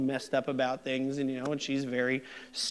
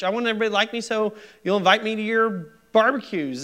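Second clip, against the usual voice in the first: higher, faster, louder rush of eager wants; building excitement; 7 semitones higher, 4 dB louder, faster than usual. Shown as a demonstration. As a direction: gush like an eager people pleaser. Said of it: "I want to please everybody".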